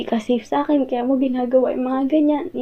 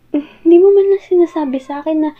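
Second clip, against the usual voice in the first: tearful; 2 semitones higher, 4 dB louder than usual. Acted. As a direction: voice breaking with hurt. Hurt partner confronting late return.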